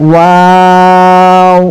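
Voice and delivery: ওয়াও held very long with rounded lips; ওয়াও গোল মুখে টেনে; rounded drawn waw. Shown as a demonstration. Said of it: "তিন আলিফ পরিমাণ টান দিয়ে পড়তে হবে".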